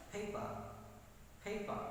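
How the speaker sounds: PAPER as "PAY-puh", long first vowel; English pronunciation. Shown as a demonstration. Teacher drilling the word for students, English pronunciation. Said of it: "in English it is pronounced".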